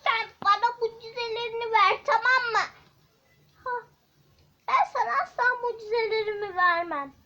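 two figures squaring off loudly; loud demand. Acted. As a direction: insist and refuse, fast and loud. Demanding and refusing.

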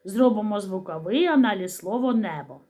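ordinary delivery

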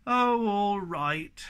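drawn-out, grudging assent; long-suffering surrender. Acted. Snork gives in grudgingly at last.

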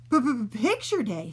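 sputtering. Acted. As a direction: stutter out the dreaded words. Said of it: "sputtered Oliver".

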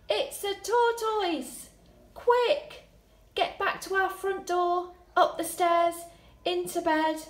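high, loud startled cry, then urgent flight; surprised shout. Shown as a demonstration. Teacher acting the shock of finding the tortoise. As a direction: gasp it out and run. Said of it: "I'm really surprised that it's a tortoise".

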